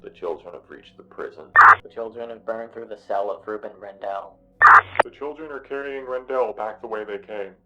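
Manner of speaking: radio dispatch over a crackling scanner. A narrator's reading. Dispatcher reporting over the scanner, flat radio tone. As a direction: read it like a tinny police radio.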